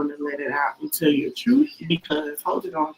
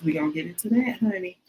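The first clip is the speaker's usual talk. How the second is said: ordinary delivery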